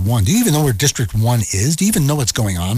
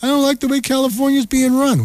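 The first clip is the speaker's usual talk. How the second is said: mock crying whine; 11 semitones higher than usual. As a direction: whimper like a spoiled brat. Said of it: "from you brats out there".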